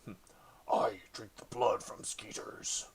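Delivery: slow, whispered demon from the sword; demonic voice. Acted. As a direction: hiss it slowly like a possessed blade. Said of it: "a demonic voice sort of pops back at you".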